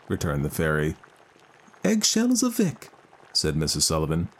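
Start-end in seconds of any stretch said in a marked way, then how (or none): 1.8-2.9 grieving mother voice. Acted. Mother answers.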